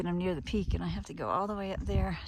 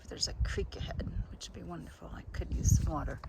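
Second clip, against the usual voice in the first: whispering; 5 dB quieter than usual. Real, not acted. Tired aside to herself.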